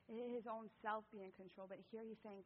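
normal talking voice

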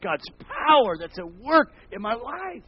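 loud, high preaching cry; fiery preaching. Acted. Preacher crying out to the congregation, loud and high. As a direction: preach it out at full force.

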